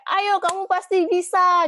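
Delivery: high, loud, slow hammed-up pep talk; mock the friend who just shouts encouragement; pushy cheerleading. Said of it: "kamu nggak perlu harus kayak".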